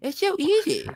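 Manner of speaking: high, nasal cartoon boy whine; Cartman impression. Doing Cartman because a friend asked. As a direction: whine it like a bratty cartoon kid. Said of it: "Can you do Cartman?".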